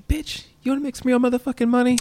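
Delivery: high, squeaky cartoon kid; squeaky South Park kid voice; Butters pimp voice. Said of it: "When Butters is sitting in class".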